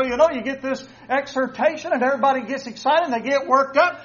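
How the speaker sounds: ordinary delivery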